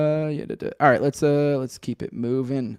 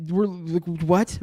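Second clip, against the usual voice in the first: normal talking voice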